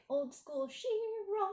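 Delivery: high, stretched-out old cartoon title cry; playful drawn-out call. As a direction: draw the name out high, in play.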